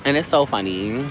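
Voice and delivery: normal talking voice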